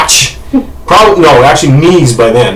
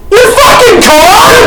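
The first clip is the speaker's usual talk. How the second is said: high, slow incredulous shout; outraged wife; slower than usual. Retold or imitated. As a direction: shriek it back in disbelief. Acts out wife yelling back.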